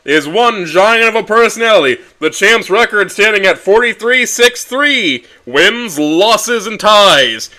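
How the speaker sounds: booming, high announcer call, drawn out; ring announcer. Acted. Ring announcer booming the champion's name to the crowd.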